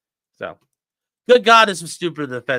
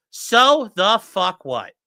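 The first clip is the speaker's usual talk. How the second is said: slow emphatic; 3 semitones higher, 4 dB louder, much slower than usual. Shown as a demonstration. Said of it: "repeats phrase slowly".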